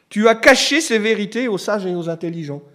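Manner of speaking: verse declaimed high and loud; emphatic quote. Acted. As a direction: proclaim the verse to the hall.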